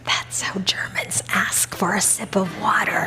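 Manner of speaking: hushed, unvoiced explanatory whisper; imitating a man's confidential whisper. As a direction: whisper it discreetly into someone's ear. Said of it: "he whispers in my ear".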